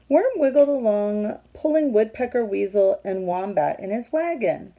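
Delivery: loud, lively storybook read-aloud; reading to children. A narrator's reading. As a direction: read it out big for the kids. Reading picture book aloud.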